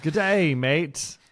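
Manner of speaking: lightly put-on Aussie "Good day, mate"; hammed-up Australian hello; Australian accent. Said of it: "I tried to do my best Australian accent".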